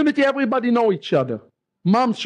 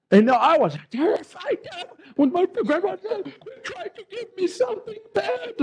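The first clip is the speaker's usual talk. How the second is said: high-pitched, tearful little-boy wail, acted out; crying child; 9 semitones higher than usual. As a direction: relive it as a panicked, crying seven-year-old. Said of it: "I cried. Tears start coming".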